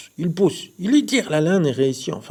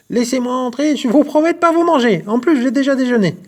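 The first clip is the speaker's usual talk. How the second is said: high, loud wolfish yell; hungry wolf howling to be let in; 12 semitones higher, 10 dB louder than usual. A narrator's reading. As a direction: bellow it through the mitten. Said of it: "il hurle".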